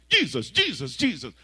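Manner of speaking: loud, high desperate calling; panicked cry. Retold or imitated. Sleeper waking late, crying out loud to Jesus. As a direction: call out the name in alarm.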